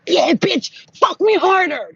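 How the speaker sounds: loud, high-pitched goading cry; taunting yell. Retold or imitated. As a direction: egg on your attacker. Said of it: "I'm like this to her".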